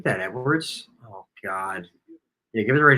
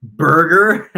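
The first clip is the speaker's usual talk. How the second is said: put-on louder, higher questioning American accent; mock American accent; 4 semitones higher, 9 dB louder than usual. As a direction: exaggerate an American accent as a joke. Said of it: "let me hear your American accent".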